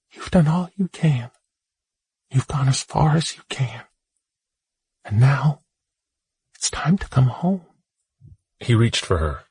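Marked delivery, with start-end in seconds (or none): 0.1-7.6 much higher, tender mother voice; loving mother. Acted. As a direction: call him home like a gentle mother. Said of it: "she said to him lovingly".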